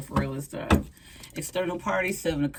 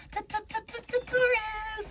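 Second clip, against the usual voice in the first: teasing singsong call. Pretend. Admirer stammers Taurus teasingly.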